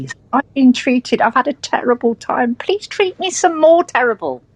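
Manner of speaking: mock pleading victim, pitch climbing; mock victim plea. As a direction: mimic a self-pitying victim begging. Mocking a self-pitying victim.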